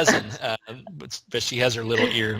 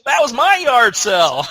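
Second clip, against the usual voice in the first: higher and louder, landing the punchline; delighted realization; 10 semitones higher, 5 dB louder than usual. Retold or imitated. Owner realizing the stranger shopped at her sale, excited. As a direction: exclaim it like the mystery just solved itself. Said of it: "And she goes".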